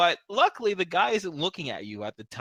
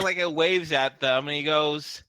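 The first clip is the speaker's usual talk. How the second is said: ordinary talk, nothing marked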